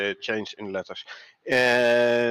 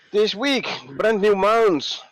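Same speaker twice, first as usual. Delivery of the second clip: hyped announcer; 10 semitones higher, 6 dB louder, slower than usual. Acted. Hyped announcement of new feature.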